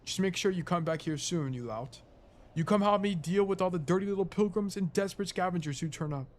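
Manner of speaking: an English caretaker voice, roused and cross; English accent, telling off an intruder. Acted. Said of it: "an English accent".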